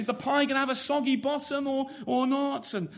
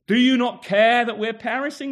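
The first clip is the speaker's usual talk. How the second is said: louder, urgent question from the storm; mild panicked cry; 10 dB louder than usual. Retold or imitated. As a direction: call it out over the waves, alarmed. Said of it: "the disciples had said".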